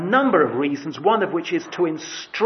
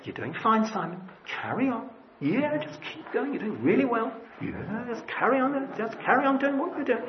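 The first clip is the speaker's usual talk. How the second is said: higher, softer, soothing reassurance; light mock praise from the Lord; encouraging inner voice; 5 semitones higher, 6 dB quieter than usual. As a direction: pat him on the back with your voice. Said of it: "you won't hear that voice saying".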